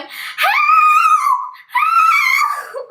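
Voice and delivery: imitated shriek, stretched out very high; high-pitched croaky scream. Lost girl screaming for rescue, high croaky voice. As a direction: croak out a mock scream for help. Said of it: "with a really high-pitched croaky voice".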